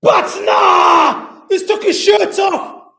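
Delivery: high, loud goal shout; long goal yell. Real, not acted. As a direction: scream the goal call.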